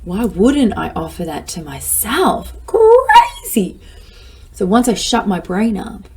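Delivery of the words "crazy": incredulous exclaim. Real, not acted. Exclaiming at absurdity.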